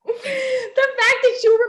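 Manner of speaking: high laughing squeal. Real, not acted. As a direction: burst out laughing as you say it. Laughing hard at memory.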